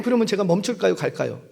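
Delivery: ordinary talk, nothing marked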